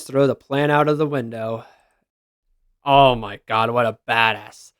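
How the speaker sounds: ordinary delivery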